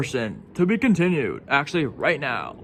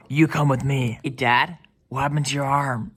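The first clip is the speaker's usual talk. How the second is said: ordinary talk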